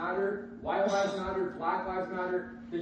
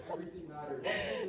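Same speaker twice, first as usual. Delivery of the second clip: laughing; 6 dB quieter than usual. Real, not acted. Dad repeating with laughter.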